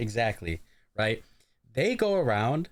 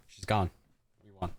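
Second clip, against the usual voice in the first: ordinary delivery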